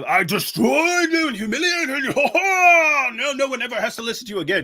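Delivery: high, sneering victory voice with a cackle; mocking gloating debater. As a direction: lord the win over the loser, laughing at him. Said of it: "debates do not have to be a".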